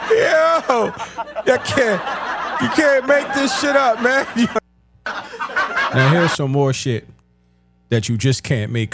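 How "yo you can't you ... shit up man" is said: high, laughing through tears; can barely get it out for laughing; real laughter pitched way up; laughing hard. Real, not acted.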